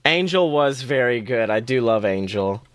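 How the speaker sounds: ordinary delivery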